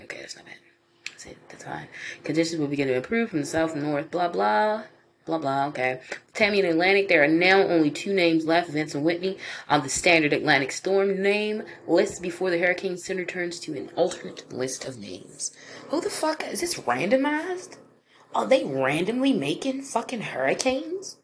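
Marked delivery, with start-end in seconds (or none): 0.0-1.9 near-silent relieved whisper; whispered relief; whispered aside. Real, not acted. Relieved aside while reading. 15.9-21.2 much higher, disbelieving squeak; high incredulous outburst. Real, not acted. Baffled by the storm names.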